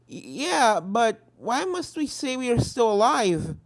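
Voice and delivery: timid pony. Acted. Timid friend stammers.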